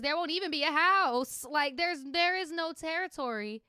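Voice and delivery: lightly voiced dramatic Jessica Lange, high and loud; a touch of grand actress drama. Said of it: "In my Jessica Lange voice".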